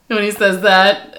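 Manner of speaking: usual speaking voice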